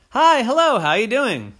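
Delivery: high, loud bubbly greeting; bright hello voice; cheery greeter. A narrator's reading. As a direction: chirp it out like a friendly neighbor. Chatty people greeting Oren.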